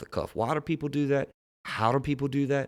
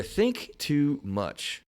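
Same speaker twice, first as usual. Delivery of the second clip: "think too much" slow and higher, heavily stressed; all-caps emphasis; 5 semitones higher, much slower than usual. Real, not acted. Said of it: "all caps, you see there".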